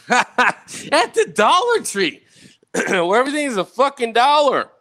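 high, slow words broken by real laughter; laughing. Real, not acted. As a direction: laugh your way through the punchline. Laughing at his own story.

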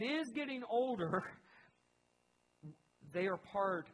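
rising preaching. Acted. Pastor building to honour the faithful generation, rising preaching. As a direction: lift the congregation as you build.

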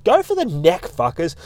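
emphatic rallying shout, pitched well up; go for the neck. Real, not acted. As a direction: egg the fighters on, hard. Urging fighters, shouting advice.

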